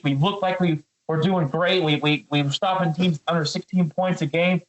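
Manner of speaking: ordinary talk, nothing marked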